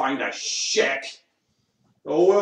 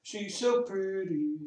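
higher sing-song; 6 semitones higher, 4 dB quieter than usual. Shown as a demonstration. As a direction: gush it up high like to a pet. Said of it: "repeats the phrase in different voices".

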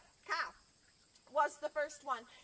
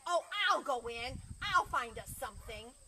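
confident goat; 7 dB louder than usual. Acted. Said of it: "then Goat said".

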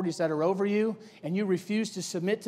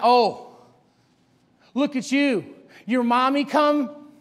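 higher, louder, drawn-out teasing; mocking taunt; 6 semitones higher, 9 dB louder, slower than usual. Hophni and Phinehas taunting Samuel about his coat, mocking. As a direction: jeer at a mama's boy. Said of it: "Making fun of his relationship with his family".

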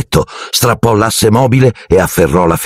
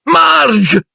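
high shouted name; alarmed cry. A narrator's reading. Vernon and Petunia cry out as Marge inflates. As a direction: call out in alarm. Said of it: "gridarono zio Vernon e zia Petunia".

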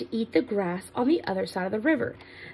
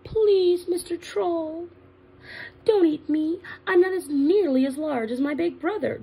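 mildly pleading, higher goat voice; a little frightened; 7 semitones higher than usual. Acted. Medium goat begs troll not to eat him, mildly scared. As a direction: plead gently in a raised pitch. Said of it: "was a little frightened".

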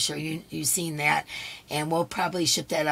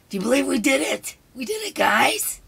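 real excitement, higher and louder; thrilled, pitched-up celebration; excited; 8 semitones higher, 5 dB louder than usual. Real, not acted. Host celebrating four K with the viewers, excited.

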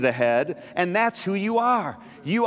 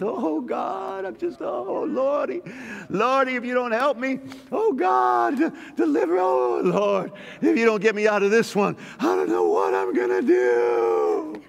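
high, wailing mock plea to God; whiny defeated prayer; 7 semitones higher than usual. Preacher mocking believers who pray from beneath, high desperate wail. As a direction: whine like a helpless believer begging the Lord. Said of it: "Stop talking like that".

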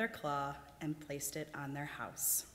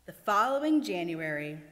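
plain reading voice

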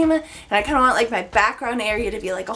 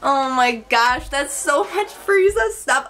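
voice all over the place; 2 semitones higher than usual. Real, not acted. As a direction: voice breaking and jumping around. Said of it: "my voice is all over the place".

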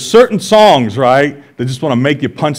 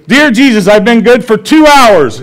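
put-on young daughter's voice, laughing; 8 semitones higher, 7 dB louder than usual. Dad voicing his daughter's last try, laughing through it. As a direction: play the kid cutting it down to hours.

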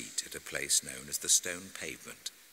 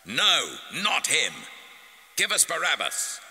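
high, loud crowd shout; full-voiced shouted demand; shouting back; 10 semitones higher, 7 dB louder than usual. A narrator's reading. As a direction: yell it out like an angry mob. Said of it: "They shouted back".

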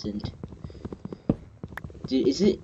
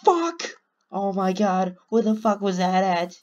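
high-pitched alarmed outburst; startled cry; 7 semitones higher, 3 dB louder than usual. Real, not acted. Player hit by something unseen in the game, startled cry. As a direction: cry out high in sudden fright.

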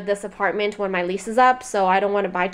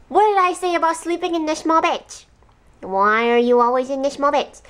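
pet scolding; 4 semitones higher than usual. Real, not acted. Scolding Michi for sleeping in the small bed, playful high voice. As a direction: tell the dog off playfully.

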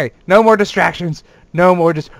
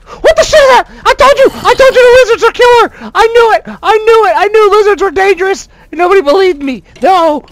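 high, loud frantic shrieks; high, loud terrified outburst; panicked yelling; 10 semitones higher, 8 dB louder than usual. Real, not acted. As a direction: scream it like the lizards are coming for you. Panicked yelling about killer lizards.

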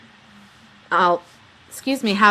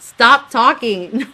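slow, louder hissed warning; urgent warning; 4 semitones higher, 7 dB louder, much slower than usual. Retold or imitated. Warning David off the chatter, slow urgent warning. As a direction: stop him before he says more. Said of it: "trying to get his attention".

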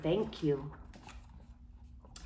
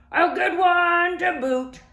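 much higher, louder, slow pirate chorus; crew agreeing; 10 semitones higher, 7 dB louder than usual. Acted. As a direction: echo it as a rowdy crew. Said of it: "the others agreed".

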